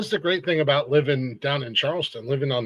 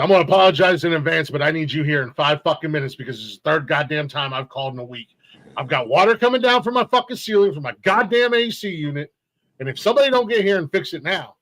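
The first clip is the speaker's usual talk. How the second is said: irate complaint; 2 semitones higher, 3 dB louder than usual. Retold or imitated. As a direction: lay down an exasperated ultimatum to maintenance. Angry phone call to maintenance.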